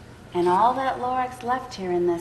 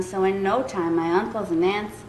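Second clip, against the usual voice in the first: ordinary delivery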